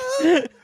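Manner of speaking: squeaky breathless laughter; helpless high-pitched cackle; high wheezing laugh. Real, not acted.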